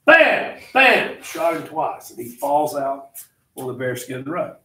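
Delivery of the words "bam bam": loud, high shouted gunshot noise; mock gunshot. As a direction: bark out two shots. Gunshots in the story.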